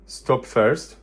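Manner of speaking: ordinary talk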